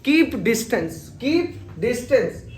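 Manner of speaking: very slow, stretching keep distance; slow clear demo. Shown as a demonstration. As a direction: model the phrase slowly for learners. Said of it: "रखो दूरी बनाए".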